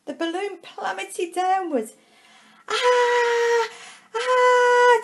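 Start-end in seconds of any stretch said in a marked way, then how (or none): 2.7-5.0 high, loud cries of fright; frightened scream. Acted. Tom screams as his balloon plummets, high frightened shrieks. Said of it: "Tom screamed with fright".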